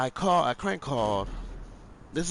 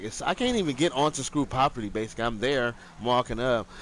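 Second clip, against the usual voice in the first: ordinary talk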